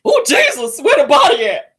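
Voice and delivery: high, loud mourning cry; hammed-up, high-pitched lament; mock funeral wailer. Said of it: "That's how her ass be".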